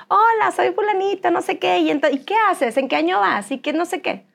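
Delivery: put-on child introducing himself. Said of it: "Preséntate, mijito".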